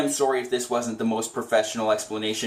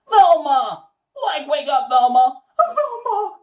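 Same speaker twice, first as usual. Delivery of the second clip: high, loud calling out; Shaggy calling Velma; 13 semitones higher, 5 dB louder than usual. Shown as a demonstration. Shaggy yelling high to wake Velma from her daydream. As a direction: call out high to snap her out of it. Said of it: "I could also do Velma too".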